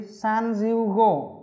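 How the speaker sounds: teacher modelling numbers slowly; slow drill. Shown as a demonstration. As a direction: drag each number out for students to copy. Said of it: "Ba mươi lăm là gì?".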